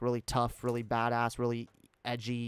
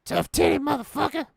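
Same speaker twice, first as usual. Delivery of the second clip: much higher, louder tough-guy character voice; a cocky, squeaky badass persona; high put-on Jay Darkay voice; 6 dB louder than usual. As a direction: strut it out as his edgy alter ego. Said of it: "I can do voices so well".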